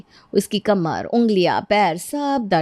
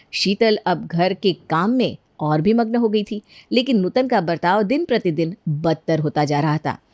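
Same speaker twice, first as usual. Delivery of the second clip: usual speaking voice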